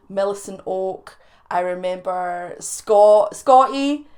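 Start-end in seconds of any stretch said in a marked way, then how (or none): 1.5-4.1 fond call-out. Acted. Loud mock-fond name calling.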